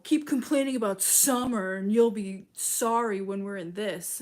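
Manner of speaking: mock warning. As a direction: wag a finger at summer complainers. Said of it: "And it was like".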